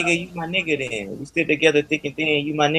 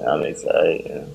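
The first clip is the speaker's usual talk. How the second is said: slow deep drawn out; 11 semitones lower, 4 dB quieter, much slower than usual. Real, not acted.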